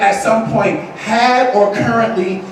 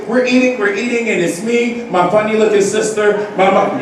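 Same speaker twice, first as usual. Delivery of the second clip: usual speaking voice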